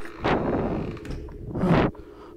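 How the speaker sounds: long, breathy exhale after a scare; long sigh. Real, not acted. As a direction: let the breath out slowly. Relief after hiding.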